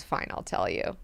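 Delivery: soft, low, half-whispered concession; hushed aside. Retold or imitated. Leto grudgingly answering his own quiz, hushed. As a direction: sigh it out quietly.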